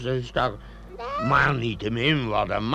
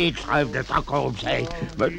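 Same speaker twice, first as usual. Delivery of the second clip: usual speaking voice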